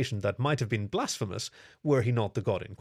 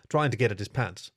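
usual speaking voice

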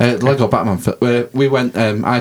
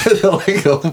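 put-on high, laughing Jamaican accent; 11 semitones higher, 3 dB louder than usual. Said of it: "Did I say that in a Jamaican accent?".

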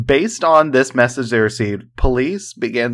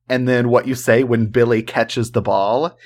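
normal talking voice

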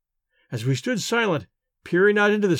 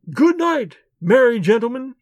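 calling out; 6 semitones higher, 5 dB louder than usual. A narrator's reading. Mocking woman calls from the lake.

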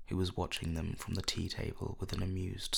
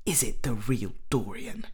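louder, exclaimed question; a touch of excited surprise; eager cry; 3 semitones higher, 6 dB louder than usual. A narrator's reading. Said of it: "cried the original of the portrait".

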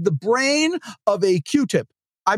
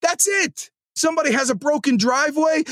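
ordinary delivery

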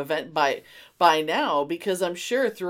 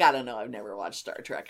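lower, fast offhand mock friend voice; imitating friend; 10 semitones lower, 4 dB quieter, faster than usual. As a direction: toss it off quick and low, mocking her. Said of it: "you were like".

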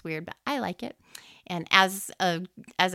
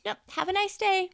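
imitating cashier; 12 semitones higher than usual. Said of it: "He goes".

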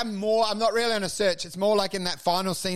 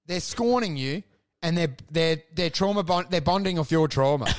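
normal talking voice

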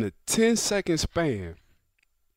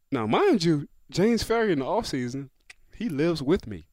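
ordinary delivery

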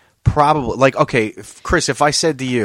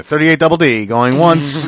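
loud auction call, laughing; auctioneer; 4 semitones higher, 7 dB louder than usual. Pretend. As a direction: rattle off the bid like an auctioneer. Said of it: "It's like an auction in here".

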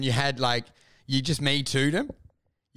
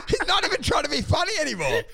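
high, pitched-up disbelief with a laugh; incredulous outburst. Retold or imitated. Comedian mocking Rogan on his podcast, high incredulous squeal. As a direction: squeal it out in mock outrage. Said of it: "I'm like".

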